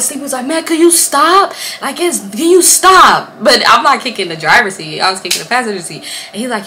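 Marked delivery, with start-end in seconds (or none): 0.5-3.3 higher, slow, drawn-out plea; mock exasperation. Man begs her to stop kicking, slow mock exasperation. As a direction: stretch it out like an annoyed driver. Driver acted as irritated.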